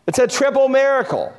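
ordinary delivery

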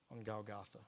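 normal talking voice

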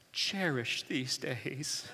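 put-on soft, slow little old lady; soft, slow old-lady voice through a laugh. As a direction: breathe it out gently like a sweet old lady. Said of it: "a little old lady who says".

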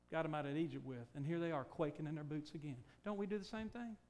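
ordinary talk

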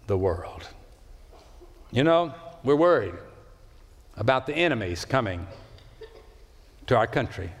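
ordinary delivery

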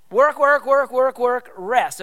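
punchy, loud drumbeat on WORK before REST; driving repetition. Shown as a demonstration. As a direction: WORK pounded loud and high on each repeat, easing on REST. Repeating the rhythm of the week.

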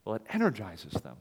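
usual speaking voice

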